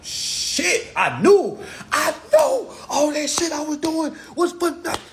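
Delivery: high whispered "shit", then a laughing rueful cry; older man realizing. Retold or imitated. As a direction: play an older man who just caught on, half laughing.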